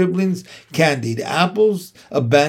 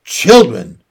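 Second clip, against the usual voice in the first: loud, sharp mother-goose scolding; snapped; 3 semitones lower, 8 dB louder than usual. A narrator's reading. Goose silences her begging goslings, snapping. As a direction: cut the youngsters off with one loud word. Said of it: "snapped the goose".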